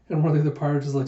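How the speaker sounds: usual speaking voice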